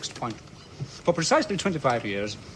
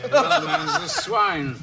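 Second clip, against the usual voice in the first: laughing jibe; much slower than usual. Acted.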